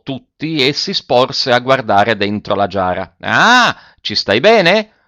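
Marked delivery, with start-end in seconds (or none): 3.2-5.0 mock friendly taunt. Zirafa taunts man in jar.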